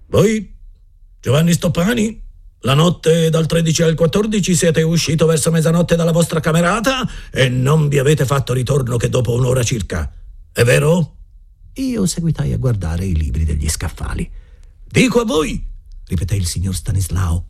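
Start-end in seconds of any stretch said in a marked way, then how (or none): 0.1-11.1 high, severe headmaster voice; stern interrogation of a pupil. A narrator's reading. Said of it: "con accento severo". 14.8-15.7 high insistent headmaster; raising his voice. A narrator's reading. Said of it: "alzando la voce".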